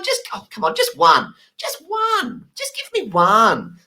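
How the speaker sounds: very high squeaky begging; wheedling plea. Retold or imitated. Egyptian guy begging for a cigarette, very high squeaky. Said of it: "the guy's going".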